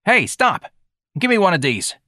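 put-on high, pushy boy's voice; brash street kid. A narrator's reading. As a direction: demand it like a cheeky young tough. Voicing street boy George in story.

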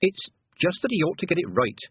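mildly embarrassed excuse; ashamed mumble. A narrator's reading. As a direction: excuse yourself, a touch ashamed. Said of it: "she mumbled, ashamed of herself".

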